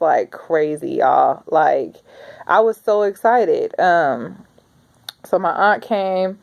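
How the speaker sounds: ordinary talk, nothing marked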